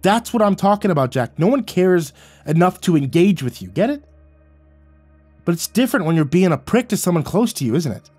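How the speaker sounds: loud, high angry tirade. A narrator's reading. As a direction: shout him down and keep hammering. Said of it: "I raised my voice and cut him off".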